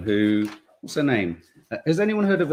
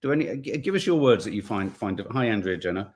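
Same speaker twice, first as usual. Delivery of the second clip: ordinary talk, nothing marked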